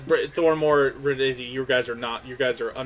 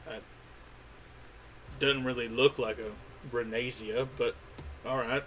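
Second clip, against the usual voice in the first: doubtful clerk. Acted. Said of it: "so he goes".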